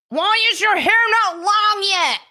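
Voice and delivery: high, loud nagging outburst; mock impatient yell. Streamer imitating impatient commenters about hair, loud mock yell. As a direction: yell it like a whiny demanding commenter.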